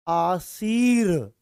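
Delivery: आशीर्वाद drawn out slowly, each syllable held; slow stretched word. Shown as a demonstration. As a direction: stretch आशीर्वाद to show where the r sounds. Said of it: "ऐसे ही तो बोलते हैं". (आशीर्वाद धीरे और खींचकर, र की ध्वनि साफ़)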